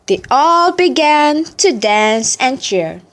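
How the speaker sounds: plain reading voice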